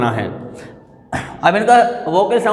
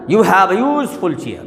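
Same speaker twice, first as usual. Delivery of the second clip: slow sentence with a long USE in useful; stress on USE; much slower than usual. Shown as a demonstration. Teacher giving another example sentence, useful stressed up front. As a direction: lean on the first syllable of useful. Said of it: "इस पर stress आया".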